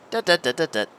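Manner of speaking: humming dut sounds. Real, not acted. Hums while ball rolls.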